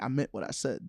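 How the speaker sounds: blunt deadpan. Retold or imitated. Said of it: "be like".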